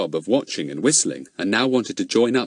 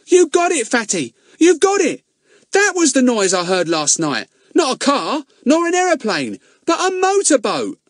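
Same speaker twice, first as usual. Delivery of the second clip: much higher, louder, bursting with excitement; excited boy Ern; 6 dB louder than usual. Acted. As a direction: shout the discovery out.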